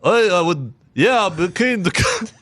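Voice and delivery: high, slow Eastern European voice; Russian accent. Pretend. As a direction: Nico answering in heavy accent. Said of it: "And then I go".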